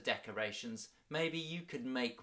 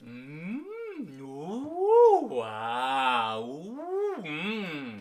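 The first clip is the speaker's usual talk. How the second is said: vocal warm-up sirens; 4 semitones higher, 8 dB louder, much slower than usual. Shown as a demonstration. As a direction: slide the voice up and down. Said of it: "magic spell to warm up our voices".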